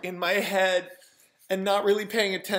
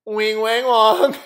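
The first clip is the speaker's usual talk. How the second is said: WONG loud, on a rising tune; sing-song build to a loud WONG; catchphrase blurted out; 2 semitones higher, 9 dB louder, slower than usual. Retold or imitated. Skinny guy in line answers the café worker, in a loud sing-song catchphrase. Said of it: "And he goes".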